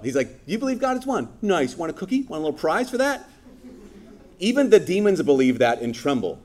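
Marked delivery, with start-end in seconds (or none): 0.5-3.3 mocking, much higher, sarcastic praise; sassy sarcasm. James mocking believers who only believe, sassy and high. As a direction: talk down like offering a child a cookie. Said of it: "he gets super sassy".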